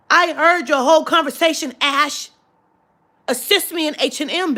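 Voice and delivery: re-enacted angry demand for service; angry outburst. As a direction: call the clerk out angrily. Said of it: "I was so fucking angry".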